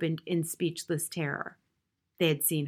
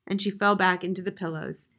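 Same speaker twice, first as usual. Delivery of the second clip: ordinary delivery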